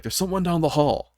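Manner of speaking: mildly urgent retold warning; urgent callout. Teammate warning the squad of someone nearby, urgent callout.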